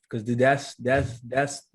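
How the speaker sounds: ordinary talk, nothing marked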